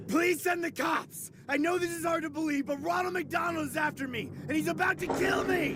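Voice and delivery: high, frantic yelling through pain; panicked shouting. Acted. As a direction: beg the operator in panic. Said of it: "wincing at the pain of his severed arm".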